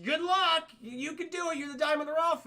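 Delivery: put-on high-pitched old man voice; creepy old man. Old man sends Aladdin into cave, high encouragement. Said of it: "the old man's like".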